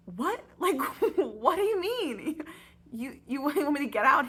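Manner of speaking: can't believe what you're hearing; baffled disbelief. Retold or imitated. Jay reacts to being thrown out, incredulous half-laugh.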